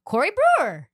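David Stern's funny announcement. Retold or imitated. Host mimics the draft announcement, funny name call. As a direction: imitate an odd announcement of a name. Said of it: "he said it so funny, like".

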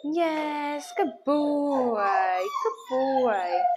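drawn-out, doting praise for a dog; delighted praise. Real, not acted. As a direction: gush over the dog, stretching the words.

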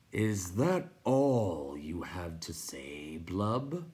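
very slow, drawn-out creature question; crackling. A narrator's reading. Rock Chewer presses Blub, slow crackle. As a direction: crackle it out, dragging the words. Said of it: "Pjorn Krachark crackled".

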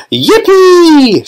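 high, loud whoop of joy; an old man whooping like a boy; joyful shout. A narrator's reading. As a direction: Grandpa Joe whooping as the lift shoots up. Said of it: "shouted Grandpa Joe again".

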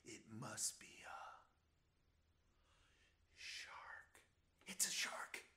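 hushed, suspenseful whisper. Pretend. Teacher whispering the shark reveal to the kids. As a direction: whisper it like a secret. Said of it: "whispered shark reveal".

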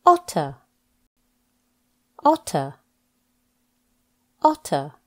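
each word spoken slowly and clearly, with a falling end; a teacher's slow, clear word-by-word drill; careful pronunciation drill. Shown as a demonstration. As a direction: model every word distinctly for the learner to repeat. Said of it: "Practice these words with me to pronounce the words properly".

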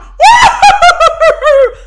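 high, loud laughing cartoon whoop. Tom reacting to the smell, a high laughing whoop. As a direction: shriek like Tom the cat. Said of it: "And Tom was like".

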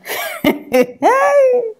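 a high burst of laughter at her claims; high mocking laugh. Real, not acted. Vlogger laughing at the actress's denial, high mocking laugh.

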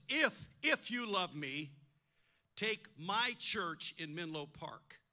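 slow, with if, if weighted hard; God slowly stressing if, if you love me; emphasis on if. Retold or imitated. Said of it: "he put the emphasis".

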